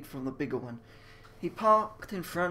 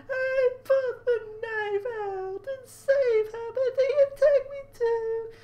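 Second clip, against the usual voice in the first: high falsetto sob, louder and slower; crying again; 7 dB louder, slower than usual. Acted. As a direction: wail it in a hammed-up falsetto. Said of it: "She began to cry again".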